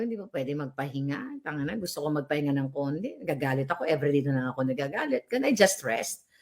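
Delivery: weary retold reply to partner, low and soft; tired complaint. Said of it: "Sabi ko".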